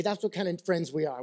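ordinary talk, nothing marked

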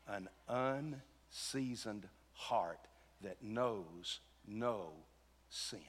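hushed, very slow, spaced-out words; solemn and slow. Real, not acted. Preacher tells church of the new heart, solemn and slow. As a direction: let each word land softly, with long pauses.

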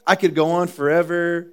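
this is fun, said slowly and lightly; breezy, slow workout voice. Retold or imitated. Pastor voicing a cyclist on the warm-up, slow and breezy. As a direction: draw it out like an easy warm-up. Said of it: "Very light intensity".

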